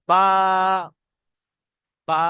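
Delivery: বা held for one alif length; slightly stretched ba. Shown as a demonstration. Teacher demonstrating Arabic letter to class, slightly stretched ba. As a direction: draw out বা a little. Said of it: "একটু টান দিয়ে পড়তে হবে".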